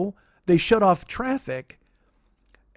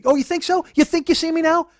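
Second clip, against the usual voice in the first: higher, mock amazed sarcasm; sarcastic disbelief; 11 semitones higher, 3 dB louder than usual. Driver mocking passenger who can't spot his car, sarcastic and higher.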